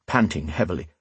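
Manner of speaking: usual speaking voice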